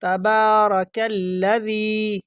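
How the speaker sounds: تبارک الذی slowly, linked as "kal-ladhee"; joined kaf and lam. Shown as a demonstration. Teacher showing the silent alif, slow linked "kal-ladhee". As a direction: chant تبارک الذی, k running straight into a held l. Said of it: "کاف کو direct الف لام کے ساتھ ملائیں".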